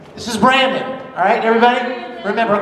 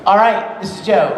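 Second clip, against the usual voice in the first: ordinary talk, nothing marked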